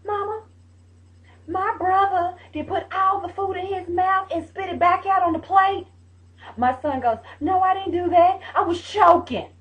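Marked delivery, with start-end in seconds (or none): 0.1-5.9 put-on high, sustained child's tattle; put-on high-pitched kid voice; tattling daughter. 7.4-9.5 high, loud mock kid protest; raised-voice child defending himself; protesting son. Son's protest acted out.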